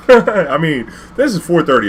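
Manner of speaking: laughing high and loud. Real, not acted. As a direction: laugh at the craziness. Laughing after running red lights.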